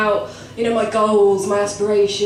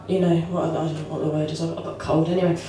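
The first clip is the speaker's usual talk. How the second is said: ordinary talk, nothing marked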